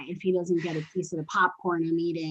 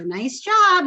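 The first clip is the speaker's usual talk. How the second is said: "Nice job" much higher and louder, gushing; overexcited praise; 10 semitones higher, 7 dB louder than usual. Shown as a demonstration. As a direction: gush "Nice job" like a thrilled owner. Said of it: "I don't go above that because if I go".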